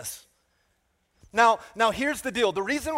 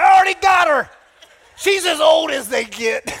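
laughing mock shout; 7 semitones higher, 10 dB louder than usual. Mock husband joke, laughing.

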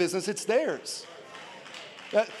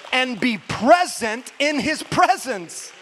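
slow, loud, raised preaching; emphatic climax; 4 semitones higher, 6 dB louder, slower than usual. Real, not acted. Preacher urges congregation to be present with God, loud and emphatic. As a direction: drive it home slowly at full voice.

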